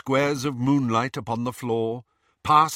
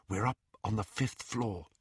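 hushed, much softer voice; whispered; 8 dB quieter than usual. A narrator's reading. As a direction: keep it hushed while sneaking. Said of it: "he whispered".

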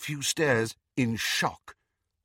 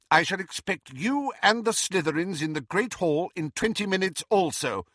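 higher, firm schoolmistress; stern McGonagall; 7 semitones higher than usual. A narrator's reading. Professor ordering Slughorn to bring his students, stern. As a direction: issue it as an order.

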